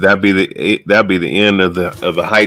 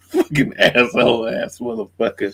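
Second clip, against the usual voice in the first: laughing. Real, not acted. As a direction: crack up while cursing.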